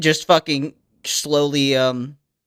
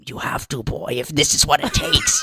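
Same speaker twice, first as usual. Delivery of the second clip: low whispered start, soft and grave; hushed elder; 5 semitones lower, 6 dB quieter than usual. Acted. Elder telling the boy the price, hushed. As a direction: insist in a dark whisper.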